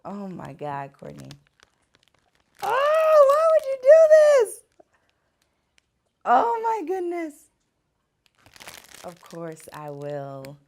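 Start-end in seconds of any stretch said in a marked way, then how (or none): 2.6-7.3 high, loud, overwhelmed squeal of disbelief; shocked shriek. Real, not acted. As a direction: shriek at the surprise proposal. Surprise marriage proposal on camera.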